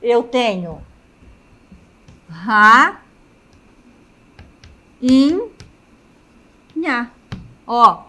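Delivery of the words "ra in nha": ra, in, nha said as separate beats with pauses; split into syllables. Shown as a demonstration. Teacher splitting rainha into syllables for the class. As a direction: break the word into syllables slowly for the class. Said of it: "Se nós fizermos a separação silábica".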